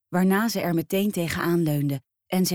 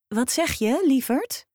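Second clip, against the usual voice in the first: put-on nosy old neighbour; 8 semitones higher than usual. Old neighbour asks again.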